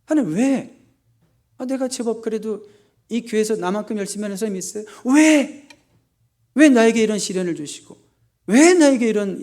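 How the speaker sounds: high-pitched, loud whining protest to God; a believer who feels wronged, whining why me; aggrieved complaining prayer. Retold or imitated. Church member in hardship complaining to God, high and whining. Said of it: "묻기를 좋아해요".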